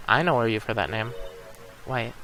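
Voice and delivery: ordinary delivery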